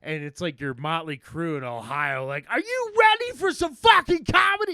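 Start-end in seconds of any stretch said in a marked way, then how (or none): 2.5-4.7 high, full-volume rock-star yell; mock arena shout. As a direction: hype the crowd like a stadium frontman. Acts out rock-star stage entrance.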